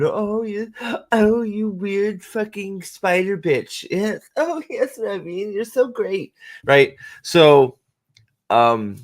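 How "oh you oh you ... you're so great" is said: high-pitched mock cooing; cuddly gushing. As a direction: coo it in a playful put-on high voice. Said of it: "doing the little cuddle thing".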